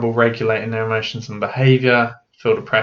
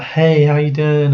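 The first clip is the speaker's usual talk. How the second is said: imitating the dad's friendly, louder greeting; mock-friendly hello, a bit louder; charismatic dad; 4 semitones higher, 5 dB louder than usual. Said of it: "be like".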